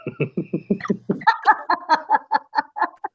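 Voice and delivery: high, sustained laughing fit; real laughter. Real, not acted. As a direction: crack up at your own crude joke. Laughing at own crude joke.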